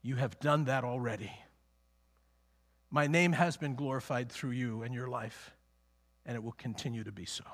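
low, soft fatherly answer; quiet assurance. Acted. Preacher as God answering his Son, quietly assuring.

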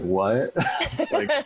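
a baffled "what" breaking into a laugh; laughing disbelief. Real, not acted. Telling friends about a weird retreat request, laughing. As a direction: laugh it out, caught off guard.

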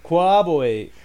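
"Corroborate" with the r's swallowed, like "co-abo-ate"; playful mispronunciation. Retold or imitated. Joins in mocking own slip.

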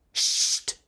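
scharf gezischtes Psst; zischend. Acted. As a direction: leise zischend zum Schweigen mahnen. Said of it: "zischte Grumm". (gezischtes Psst)

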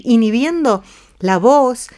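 ordinary talk

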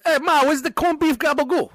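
mock Italian-American son hollering for Ma; fake Italian accent. Mock Italian son demanding food.

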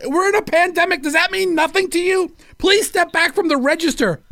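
high, whiny mock outrage; mock indignant customer. Customer scolding the clerk, high and whiny. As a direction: lecture her like a prissy hypochondriac. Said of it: "he's like".

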